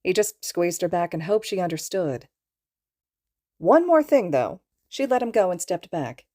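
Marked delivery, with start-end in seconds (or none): 3.6-4.6 sudden higher, louder, brisk tone; firm warning. A narrator's reading. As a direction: switch from tender to pointed. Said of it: "The softness had vanished from her voice".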